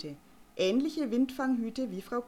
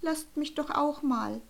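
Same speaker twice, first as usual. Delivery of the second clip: timid stammer; 7 semitones higher than usual. Acted. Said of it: "stammelte bloß schüchtern".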